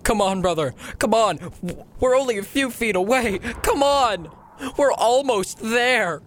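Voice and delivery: high, desperate pleading; very distressed. Acted. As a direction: beg a dying brother to keep going. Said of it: "said in a very distressed tone".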